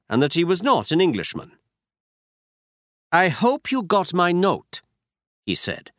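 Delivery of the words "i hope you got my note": put-on a count with a German accent, pitched higher. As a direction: greet them in a German accent. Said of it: "He had a strong German accent".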